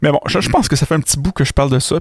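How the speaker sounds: ordinary talk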